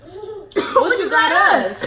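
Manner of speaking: loud, drawn-out question through laughter; laughing outburst. Real, not acted. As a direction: burst out laughing as you ask. Table laughing over spicy food.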